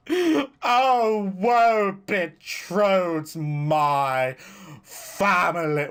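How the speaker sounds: theatrical woe, drawn out; hammed-up tragic lament. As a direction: wail it like a tragic stage hero.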